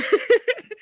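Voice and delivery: quick high burst of laughter; bright giggle breaking through; short laugh. Real, not acted. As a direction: laugh at your own joke. Laughs at her own joke.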